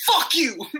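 sudden high-pitched retold outburst; energetic cussing. Imitating the dealer snapping at whoever points, angry. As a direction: explode in mimicked anger, voice jumping up. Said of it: "He had so much energy".

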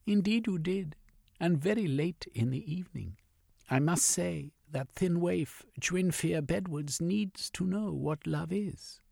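woman's voice. Acted. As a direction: voice her high and prim. Female character voice.

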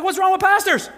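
high, loud, indignant squeak; mock offended. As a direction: act outraged, voice shooting up high. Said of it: "I'm like".

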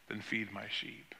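soft whispered command, almost breathless; a solemn whisper; hushed whisper. Acted. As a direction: drop to a whisper for the final charge. Jesus' final charge, whispered.

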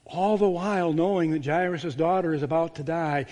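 usual speaking voice

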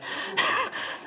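soft, breathless, high mock laugh; imitated laugh. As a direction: puff out a quick airy chuckle. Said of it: "He starts cracking up laughing".

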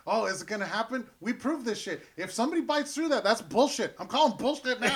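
loud, high, outraged protest; indignant outburst. Retold or imitated. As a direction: call foul, loud and worked up. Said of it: "and I'm like".

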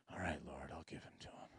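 fluistert berustend, zachter; whispered surrender. Retold or imitated. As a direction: give in to God under your breath.

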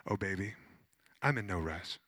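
imitating a serene older client, low and soft; calm, unhurried. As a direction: let it settle low, no hurry at all. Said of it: "She was just calm".

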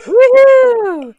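high, loud happy whoop; cheering woohoo. Real, not acted. Cheering after a joke.

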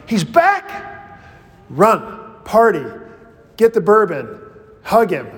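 high, bursting cry of joy on He's back; like a brother who can't wait to celebrate; mock-eager shouts of welcome; excited welcome. Brother should have run rejoicing.

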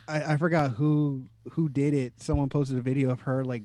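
ordinary talk

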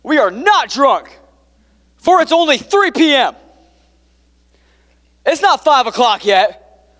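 mock-earnest Peter; Peter's logic. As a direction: argue it like a man stating the obvious. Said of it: "He goes".

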